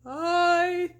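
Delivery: very slow, loud ghostly croak. A narrator's reading. Girl playing the horseman, slow loud croak. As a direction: croak it out like a risen spirit. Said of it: "Sabrina croaked".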